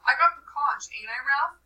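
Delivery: Piggy pleads with Ralph for backing. Acted.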